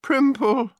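high-pitched weeping call; tearful wail. A narrator's reading. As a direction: wail the name as if in tears. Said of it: "wept Gemma".

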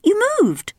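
put-on high matter-of-fact young princess; brisk, unbothered princess; princess Belinda. A narrator's reading. Princess scolding her father, high and matter-of-fact. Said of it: "she said".

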